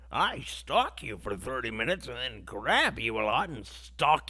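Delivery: slow, hammed-up old-movie lover; mock creepy lover. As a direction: parody the creepy romantic lead. Mocking the movie's stalker hero.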